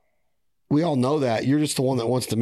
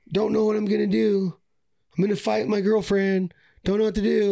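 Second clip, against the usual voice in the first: higher, put-on whiny buddy voice; mocking a whiny buddy; 8 semitones higher than usual. Podcast host mocking a buddy's needy post, whiny. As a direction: mimic a friend fishing for sympathy.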